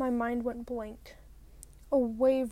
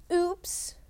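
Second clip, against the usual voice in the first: mocking bully girl; 5 semitones higher, 3 dB louder than usual. Bully taunting after dumping lunch.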